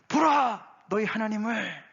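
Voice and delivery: high proclaiming call; crying out. Acted. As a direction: cry it out to the despairing captives. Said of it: "라고 외치라고".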